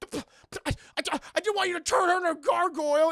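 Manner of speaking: high, loud, stammering outburst; sputter, then rush it out in mock outrage; flustered protest. Protesting to Puck about the gargoyle, acted fluster.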